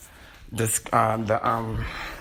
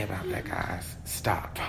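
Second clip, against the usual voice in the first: urgent whisper; 5 semitones lower, 9 dB quieter, slower than usual. Real, not acted. Hushing the people in the room.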